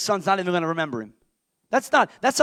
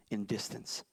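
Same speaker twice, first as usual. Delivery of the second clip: soft, low, murmured; preacher murmuring the point; hushed; 10 semitones lower, 17 dB quieter than usual. Acted. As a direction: drop to a near whisper. Hushed first take.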